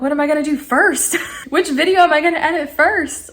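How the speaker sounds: giddy, voice lifting with each question; excited. Retold or imitated. Vlogger planning which video to edit first, excited. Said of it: "I'm just so excited".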